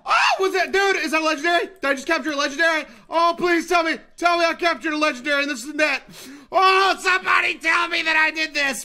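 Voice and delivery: loud, breathless-with-hope shouting; real thrilled disbelief; excited pleading. Real, not acted. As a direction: beg loudly for confirmation. Shouting to learn if catch worked.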